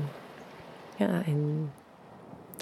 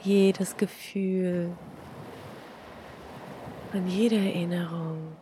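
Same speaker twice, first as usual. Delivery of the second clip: guided meditation; 6 dB quieter than usual. Acted. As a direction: breathe it out softly, long pauses.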